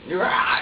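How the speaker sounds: short, loud mock baby scream; imitated baby scream. Parent mimicking the screaming baby, loud imitated scream. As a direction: burst out with a raw baby's yell. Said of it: "he's just there screaming".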